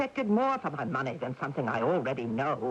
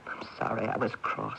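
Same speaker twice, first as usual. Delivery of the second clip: whispered apology; 6 semitones lower than usual. Acted. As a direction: barely voice the apology. Apologizing to sister after snapping.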